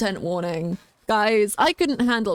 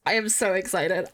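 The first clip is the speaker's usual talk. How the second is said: ordinary talk, nothing marked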